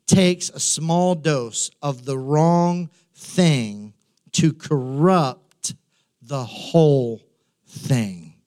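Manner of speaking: each word laid down slowly and heavily; slow, weighty emphasis. Shown as a demonstration. Preacher slowing right down to warn the congregation, weighty emphasis. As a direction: drag it out so the warning sinks in. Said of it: "He's saying, listen".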